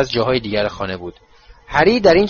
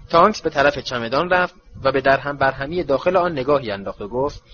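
usual speaking voice

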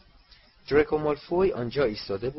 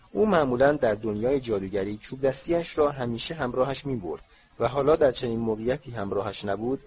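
usual speaking voice

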